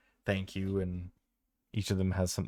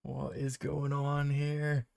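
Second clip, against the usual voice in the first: bewildered; 6 semitones higher, slower than usual. Acted. Player voicing bewildered character.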